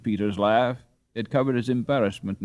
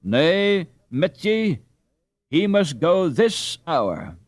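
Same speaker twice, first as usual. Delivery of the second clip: higher, louder, slow firm husband; firm old father; 5 semitones higher, 5 dB louder, slower than usual. Acted. Father overruling his wife, firm and slow. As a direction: overrule her kindly but firmly.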